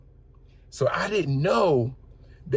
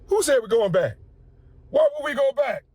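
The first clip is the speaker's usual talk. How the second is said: higher, louder indignant questions; outraged passengers; 12 semitones higher, 4 dB louder than usual. Retold or imitated. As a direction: act out the upset passengers.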